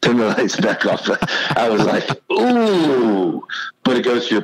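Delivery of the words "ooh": a high, pained ooh at a brutal line; mock wince. Laughing at the coach's brutal line, he gives a high wincing ooh. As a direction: react as if the coach's words stung.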